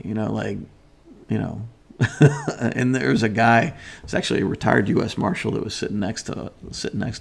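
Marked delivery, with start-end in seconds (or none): 2.0-2.5 laugh. Real, not acted. Laughing after story punchline.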